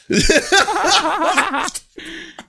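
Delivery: long, high, loud laugh; real laughing fit. Real, not acted. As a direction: laugh helplessly and let it run on. Remembering the funny video.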